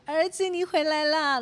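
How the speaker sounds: high, bright welcome home to her son; warm excited greeting. Retold or imitated. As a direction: call out happily as he comes in. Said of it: "我就很热情拥抱他". (热情地高声迎接儿子)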